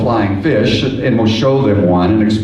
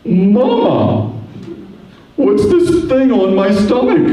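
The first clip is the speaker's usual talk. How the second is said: put-on high, puzzled little child voice; lightly voiced baby fish asking its mother; 9 semitones higher, slower than usual. Baby fish asks mama about its odd belly, high puzzled voice. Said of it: "the little fish looks down, and it says".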